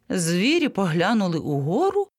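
plain reading voice

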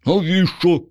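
high, wary little voice. A narrator's reading. Vanya wary of the zombie, asking in a high voice. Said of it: "насторожився той".

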